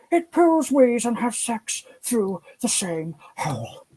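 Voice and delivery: high, slow nature-documentary narrator voice; hushed wildlife-presenter awe, drawn out slowly; David Attenborough impression. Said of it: "I say that as David Attenborough".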